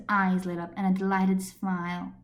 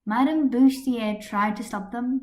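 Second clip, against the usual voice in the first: ordinary delivery, nothing marked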